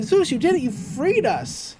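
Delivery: high, mock-elated kids' voices; imitated grateful cheer. Twins thanking Zeus for freeing them, high imitated cheer. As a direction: pitch it up high, stretching the end. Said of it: "And they're like".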